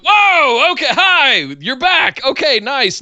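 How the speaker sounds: high, loud, excited yelp; thrilled the old Keith is back; delighted surprise. Retold or imitated. Fan reacting to Keith's angry return, high and excited. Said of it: "And I went".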